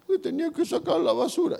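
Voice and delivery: vocecita muy aguda de niño; hijo pequeño. Acted. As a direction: imitar a un hijo pequeño respondiendo a su papá. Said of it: "¿Qué te dijo papá?". (voz aguda de niño)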